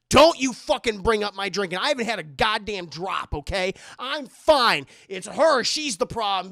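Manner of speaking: loud, defensive snap; angry denial. Retold or imitated. Wife snapping at husband over her drinking, loud and angry.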